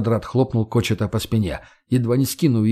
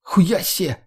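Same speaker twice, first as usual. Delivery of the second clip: high-pitched astonished female character; Margo's raised, startled exclamation; exclaiming in surprise; 8 semitones higher than usual. A narrator's reading. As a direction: blurt it out, amazed. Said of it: "воскликнула Марго".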